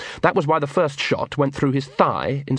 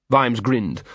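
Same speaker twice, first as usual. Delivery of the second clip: usual speaking voice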